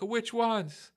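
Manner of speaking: high-pitched imitation of baffled reply; mock bewildered. Soldier questioning the news about his brothers, mock bewildered. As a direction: ask it high, as if confused.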